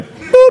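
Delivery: squeaky high boop sound. Retold or imitated. Comedian punctuating the moment, squeaky boop. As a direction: pop it out like a cartoon.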